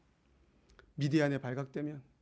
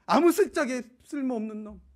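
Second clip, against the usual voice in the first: louder, scornful name-calling; mock scolding; 7 semitones higher, 11 dB louder than usual. Imagined God scolding Gideon, in loud mock scorn. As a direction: sneer out the insults like a harsh master. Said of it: "이렇게 부르신 게 아니고".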